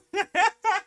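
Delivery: squeaky falsetto laugh; high giggle. Real, not acted. Laughing after the cheer.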